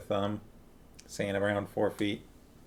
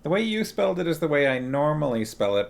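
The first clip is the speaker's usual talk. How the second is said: ordinary talk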